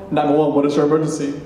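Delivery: brisk, even phone-operator patter; 911 dispatcher. Retold or imitated. Operator answers an emergency call, brisk and routine. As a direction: rattle it off like a dispatcher.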